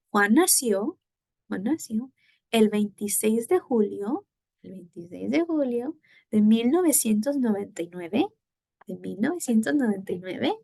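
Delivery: phrase by phrase, rising on "nació", "julio" and "nueve"; question intonation drill. Shown as a demonstration. Teacher leading students in repetition, rising question intonation. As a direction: model each piece for repeating, "nueve" lifted at the end. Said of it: "So try it with me".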